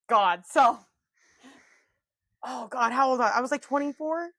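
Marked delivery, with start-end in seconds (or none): none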